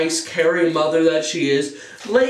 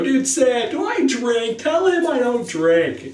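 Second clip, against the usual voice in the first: pleading friend; 9 semitones higher, slower than usual. Retold or imitated.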